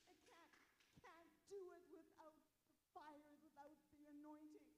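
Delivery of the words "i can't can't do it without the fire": ordinary delivery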